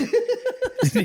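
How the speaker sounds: high squealing laugh; high burst of laughter. Real, not acted. Friend cracking up at his own story, high squealing laugh.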